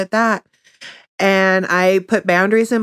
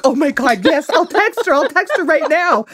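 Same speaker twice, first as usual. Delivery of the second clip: excited; 8 semitones higher than usual. Retold or imitated. Excited reply to husband.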